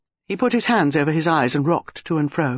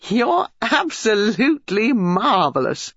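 high, drawn-out delighted exclamation; amused delight; 6 semitones higher, slower than usual. A narrator's reading. Dinah, who has just laughed, to her visitor, amused delight. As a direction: stretch it out in amused admiration. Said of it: "Dinah stopped and laughed".

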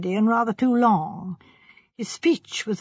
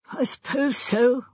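slow, slightly smothered admission; reluctant, indistinct answer; muffled voice; 3 semitones higher than usual. A narrator's reading. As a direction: mumble it slowly into the hands. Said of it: "he said in a muffled voice".

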